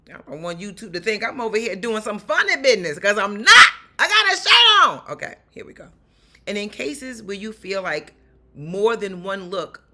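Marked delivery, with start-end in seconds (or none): 0.1-5.1 loud, rising mock protest; playful protest. Real, not acted. Laughing aside about her shirt. 5.1-5.9 whispered aside. Real, not acted. Unvoiced aside back to topic.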